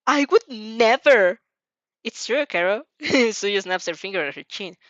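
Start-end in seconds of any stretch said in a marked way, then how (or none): 0.0-1.4 slow, much louder gasped denial; shocked protest. A narrator's reading. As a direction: blurt it out, shocked and scandalised. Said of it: "You gasp in a rush".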